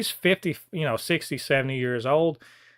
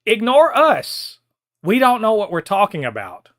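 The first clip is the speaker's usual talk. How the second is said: emphatic shout; 7 semitones higher, 7 dB louder than usual. Real, not acted. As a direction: spell it out, near shouting. Urging actor to ignore them.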